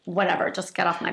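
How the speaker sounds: quick, dismissive brush-off. Shown as a demonstration. Partner who wants to be left alone, dismissive. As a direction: wave them away in a hurry. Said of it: "it's very dismissive also".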